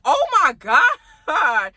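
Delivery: slow, stunned and drawn out; drawn-out oh my God. Retold or imitated. Reliving seeing a hundred missed calls, stunned and drawn out. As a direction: stretch it in disbelief.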